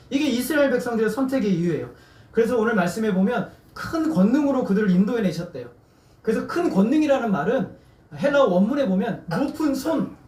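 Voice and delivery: ordinary talk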